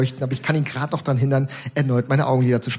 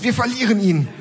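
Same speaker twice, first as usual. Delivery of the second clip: lightly voiced, higher, dramatic lady; a hint of a hammed-up worried woman; fussy middle-aged lady; 9 semitones higher than usual. Kind lady fretting over the patient, higher mock drama. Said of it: "ruft die nette Dame".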